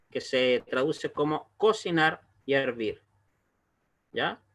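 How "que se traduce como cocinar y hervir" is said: usual speaking voice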